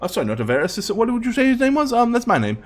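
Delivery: high wizard voice, thinking aloud; high-pitched, hesitant wizard; wizard, flustered. Acted. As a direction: play a fussy wizard muddling through his questions. Wizard questions the party.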